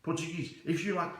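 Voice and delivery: ordinary talk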